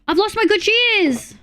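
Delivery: high, slow whiny lament; high, slow put-on sorrow; mock lament. Real, not acted. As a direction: wail it like a tragedy. Lamenting lost shears.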